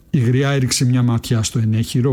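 ordinary delivery

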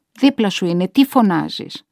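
loud irritated retort; exasperated scolding. A narrator's reading. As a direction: tell her off impatiently. Relative scolds the calling mother. (εκνευρισμένη επίπληξη)

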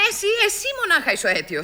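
usual speaking voice

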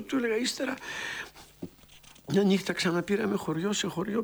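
ordinary talk, nothing marked

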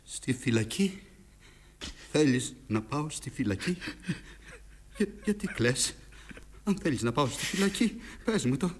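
soft, halting questions with long pauses; hushed disbelief. Acted. Father asking his crying son about prison, hushed disbelief. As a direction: ask it quietly, taken aback, pausing between phrases.

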